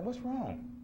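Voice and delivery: high-pitched, tender-husband question; imitated concern. Husband to his crying wife, hammed-up concern. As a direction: ask with exaggerated high concern.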